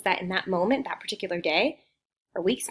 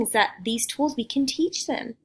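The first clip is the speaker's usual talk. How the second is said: ordinary talk, nothing marked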